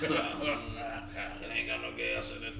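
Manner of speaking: slow, mumbling Southern drawl; Sling Blade impression. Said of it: "Y'all sound like, uh, Sling Blade".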